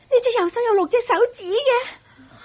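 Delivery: high-pitched disbelief; shocked discovery. Acted. Young lady finding six fingers on the maid's hand, shocked. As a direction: she can't believe what she sees.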